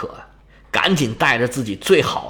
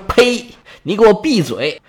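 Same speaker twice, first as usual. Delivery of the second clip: indignant outburst; 7 semitones higher than usual. Acted. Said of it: "卢克莱西亚说：".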